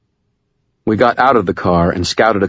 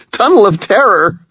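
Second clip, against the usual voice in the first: much higher, louder, nervously giddy woman; Murphy's voice; 6 dB louder than usual. Acted. Murphy joking with Harry on a spook ride, high giddy voice. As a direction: squeal it like a thrilled kid on a ride.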